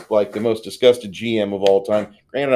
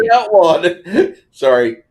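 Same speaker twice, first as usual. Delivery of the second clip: loud laughter breaking into the words; loud, laugh-filled interjection; 8 semitones higher, 8 dB louder, slower than usual. Real, not acted. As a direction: crack up at your own quip. Co-host cracking up at own joke.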